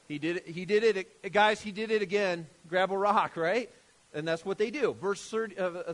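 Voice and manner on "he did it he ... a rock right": louder, riled-up alarm; mock crowd outrage. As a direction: rouse the mob to pick up stones. Crowd voiced reacting to blasphemy.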